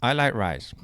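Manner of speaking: short line in a put-on Chinese accent; mock Chinese accent. Shown as a demonstration. Testing an accent on a friend, mock Chinese accent. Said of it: "just start with the accent".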